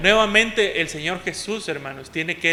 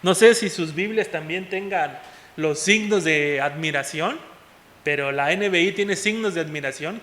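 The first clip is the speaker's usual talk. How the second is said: ordinary delivery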